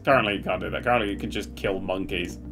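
usual speaking voice

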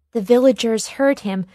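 plain reading voice

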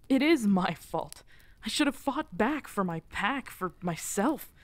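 anguished self-blame. Acted. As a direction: cry out the guilt, stumbling over the words. Breaking down over lost pack.

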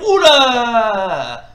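loud, drawn-out falling cry; sudden big yelp of alarm; startled shout. Real, not acted. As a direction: break off and yell at a hit. Sudden hit mid-commentary.

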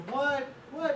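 high, short cartoon "What?"; puzzled frog. Hard-hearing frog who can't make out the jeers, high puzzled squeak. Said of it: "the frog keeps looking".

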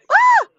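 sudden high yelp of surprise; startled cry. Real, not acted. Streamer startled by a window popping up, sharp high yelp. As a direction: jump at the screen with a sharp cry.

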